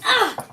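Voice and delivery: sharp high grunt of effort; a quick high shout as he throws; villain effort cry. Acted. Strahd hurling Winnie into the wall, a sharp high effort cry.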